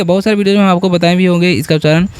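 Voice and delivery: usual speaking voice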